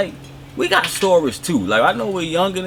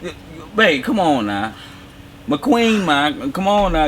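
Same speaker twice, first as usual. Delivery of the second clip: ordinary delivery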